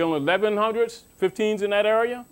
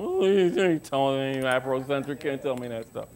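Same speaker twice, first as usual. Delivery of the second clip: mocking upset believer; 6 semitones lower, 4 dB quieter than usual. Imitating emotional people who reject his point, mock grumble. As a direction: lower and softer, a sulky huffy objection. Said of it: "I already know people get emotional".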